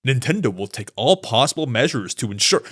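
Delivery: low, stiff mock-robot statement; robotic corporate voice. Host mocking how the president's quote reads, robotic low delivery. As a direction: imitate an official reciting like a robot. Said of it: "They make him sound like a robot".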